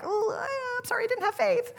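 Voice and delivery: high, soft, whimpering mock apology; mock crying. Disciple whimpering a hammed-up apology to Jesus. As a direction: play the crushed disciple, half-sobbing. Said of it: "a little bit like".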